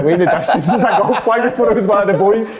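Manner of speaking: can barely tell it for laughing; laughing while telling. Real, not acted. Storyteller to friends about mistaking a golf flag, laughing.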